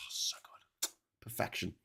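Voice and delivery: breathy hushed savoring; whispered delight. Real, not acted. Savoring the toast in a hushed whisper. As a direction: whisper it like tasting bliss.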